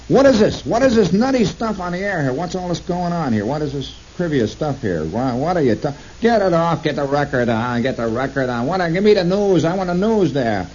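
indignant. Retold or imitated. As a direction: complain like an outraged listener. Indignant callers retold.

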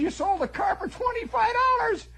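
high, loud disbelieving yell; incredulous shout. Retold or imitated. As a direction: shout it up high like an outraged kid brother. Kid brother shouting in disbelief.

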